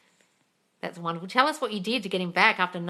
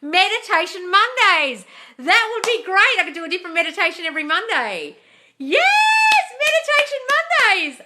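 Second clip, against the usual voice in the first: delighted shriek; 13 semitones higher, 11 dB louder than usual. Real, not acted. Host thrilled by a viewer's Monday idea, squealing.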